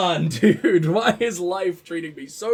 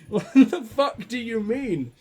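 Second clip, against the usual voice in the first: laughing disbelief; 3 semitones higher, slower than usual. Real, not acted. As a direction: laugh through the question. Laughing at a game line.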